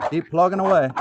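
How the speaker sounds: loud, high Minnesota accent, imitating dad; strong Minnesota accent. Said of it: "In a strong Minnesota accent".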